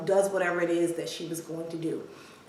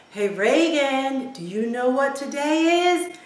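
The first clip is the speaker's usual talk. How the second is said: dad's drawn-out, higher call; 5 semitones higher, 4 dB louder than usual. Retold or imitated. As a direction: imitate a dad calling to his daughter. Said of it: "he yells out to her".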